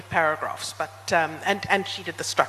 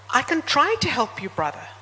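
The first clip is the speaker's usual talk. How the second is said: higher-pitched taxi driver, falling on "brother"; 6 semitones higher than usual. A narrator's reading. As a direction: voice a driver offering help. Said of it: "said the taxi driver".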